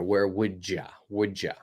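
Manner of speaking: "Would ya?" with a D sound linking into ya; casual linking; d-linked "wouldja". Shown as a demonstration. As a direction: blend "would ya" as "wood-ja". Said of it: "I can even put a D in there".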